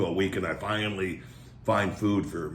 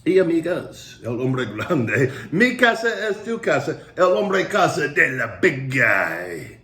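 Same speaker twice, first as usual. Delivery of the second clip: mock Spanish; 4 semitones higher, 4 dB louder, slower than usual. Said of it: "Espanol, uh, speaking".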